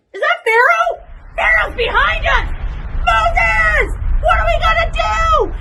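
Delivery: high, loud panicked yelling; panicked shout. Acted. Israelite spotting Pharaoh calls to Moses, panicked shout. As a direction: scream it in sudden terror.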